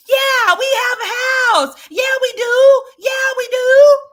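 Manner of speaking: imitating the child yelling back at mom; mock kid shout. Mimicking kid insisting on house.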